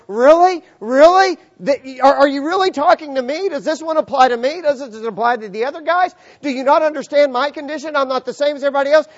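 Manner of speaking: loud, high mock disbelief; incredulous questioning. Doubting leper questioning Jesus' command, loudly incredulous. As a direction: fire off protesting questions at full voice.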